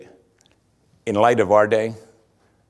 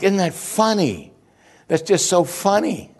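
high-pitched mock appreciation; mocking amusement; 10 semitones higher than usual. Preacher imitates people who pay for moron movies, mocking. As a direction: play a sarcastic, easily amused viewer, pitched high.

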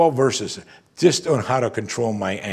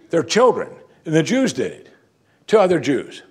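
raised, louder outrage at the wrong; indignant emphasis; 6 semitones higher, 4 dB louder than usual. Real, not acted. Preacher to congregation, raised indignant outrage. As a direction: lift the voice in disbelief at the injustice.

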